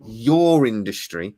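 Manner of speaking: "your" drawn out, slow and pointed; stress on YOUR. Real, not acted. Host singles out Harris's trade, stressing "your". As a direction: lean slowly and heavily on "your".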